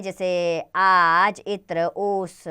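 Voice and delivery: आज, इत्र, ओस said very slowly, each word stretched out; slow demo words. Shown as a demonstration. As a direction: spell out आज, इत्र, ओस slowly for children. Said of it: "पहला अपने मूल रूप में".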